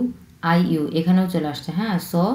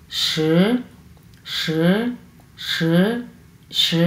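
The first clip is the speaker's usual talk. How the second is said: "স" as shi with rising tone; second tone; much slower than usual. Shown as a demonstration. Teacher modelling Chinese ten, second tone. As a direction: lift the pitch through "স", like a question. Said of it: "দুই number tone আমরা কীভাবে উচ্চারণ করবো".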